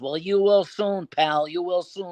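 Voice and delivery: veiled threat drawn out in a wiseguy voice; knowing prank-call gangster menace; Whitey Bulger. Acted. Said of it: "This is Whitey Bulger".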